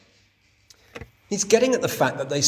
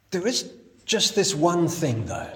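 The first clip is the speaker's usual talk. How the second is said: ordinary talk, nothing marked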